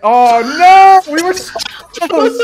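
high, loud wail of dismay; dismayed scream. Real, not acted. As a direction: cry out as it all goes wrong. Team attacked in game.

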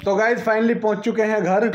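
usual speaking voice

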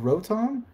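Rotom as ROH-tom, long o; "roh-TOM" with a clear t for Rotom; chosen pronunciation. Shown as a demonstration. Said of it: "you say, like, Ro, and then you say Tom".

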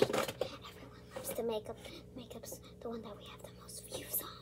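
soft whispered aside; whispering. Real, not acted. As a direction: whisper it secretly to the viewers.

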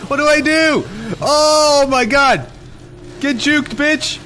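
high, loud frantic yelling while being chased; excited shouting. Real, not acted. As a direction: yell it out in panicked glee. Fleeing the monster in game.

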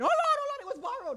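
high, loud wail of dismay; hammed-up anguished cry. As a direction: cry out in mock distress. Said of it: "the last time the man cried".

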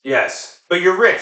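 ordinary delivery

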